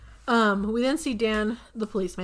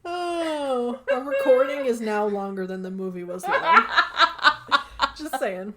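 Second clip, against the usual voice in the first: laughing through words; 4 semitones higher, 5 dB louder than usual. Real, not acted. Laughing fit after Krampus bit.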